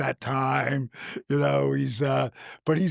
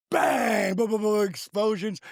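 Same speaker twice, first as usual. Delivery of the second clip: excited explosions; 7 semitones higher than usual. Retold or imitated. Mimicking entrance explosions.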